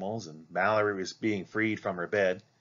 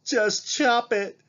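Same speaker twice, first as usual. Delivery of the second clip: put-on high, slow, tearful girl's plea; girl sobbing to her mother, high and slow; 5 dB louder, much slower than usual. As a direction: beg through tears, much higher. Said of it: "Mallory sobbed".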